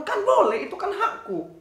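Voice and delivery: high laughing cheeky claim; a child laughing while claiming rights; laughing defiance. Acted.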